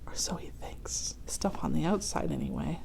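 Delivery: secret whispered aside; hushed aside. Acted. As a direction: lean in and whisper the hint.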